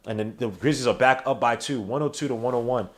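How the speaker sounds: sports announcer calling the game; live play-by-play. Acted. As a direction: call it like a broadcast announcer.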